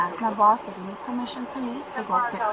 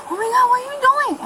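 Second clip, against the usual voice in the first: high, fast, mock-horrified cry; imitating a shocked gasp; 12 semitones higher, 3 dB louder, faster than usual. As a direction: mimic her shrill alarm, hammed-up. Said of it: "She said".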